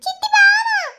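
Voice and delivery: high, tearful calls for mother; crying child. Acted. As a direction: call out for mother through sobs.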